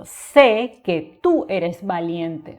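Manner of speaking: slow, deliberate dictation; slow teacher's example; slow example read. Shown as a demonstration. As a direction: read it out slowly for the class. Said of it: "Por ejemplo".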